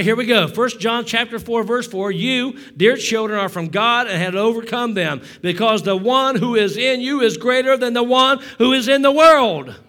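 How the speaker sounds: loud, high, driving sermon voice; preaching at full force. Acted. Pastor preaching hard to his congregation. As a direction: preach it hard and build the room.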